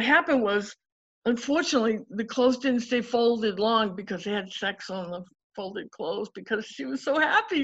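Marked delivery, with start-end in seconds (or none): none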